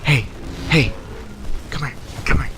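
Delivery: short, hushed calls to lean closer; furtive beckoning. Retold or imitated. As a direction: imitate a customer sneakily beckoning someone over. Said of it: "he looks at me and he goes".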